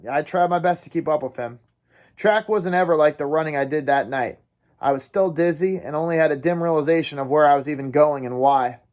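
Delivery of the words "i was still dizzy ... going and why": ordinary delivery, nothing marked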